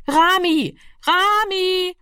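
put-on calling a lost boy, high and carrying. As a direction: call his name across the house. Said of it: "tönte es von hier und dort".

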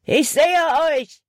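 high, loud shout across the gorge; calling out. Acted. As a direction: yell it at the fugitives. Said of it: "rief Hutton".

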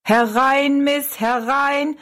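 high, drawn-out barker's call; showman calling. A narrator's reading. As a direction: call the customers in, stretching the words. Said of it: "rief er Rosalie zu". (lang gezogener Ausruf eines Schaustellers)

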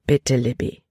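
tief, sanft, aber fest; sanft und bestimmt. A narrator's reading. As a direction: bitte sie ruhig, aber unnachgiebig. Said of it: "Stimme war sanft". (Vater schickt die Tochter fort, sanft und bestimmt)

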